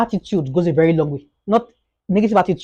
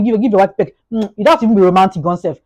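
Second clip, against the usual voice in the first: ordinary delivery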